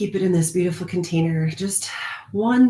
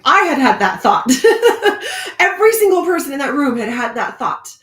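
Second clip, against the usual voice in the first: laughing; 7 semitones higher, 8 dB louder than usual. Real, not acted. Laughing recognition.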